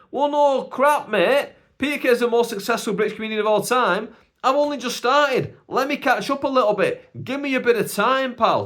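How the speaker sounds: high, loud, exasperated retort; comic defensive outburst; indignant protest. Acted. Indignant retort to unfair comparison.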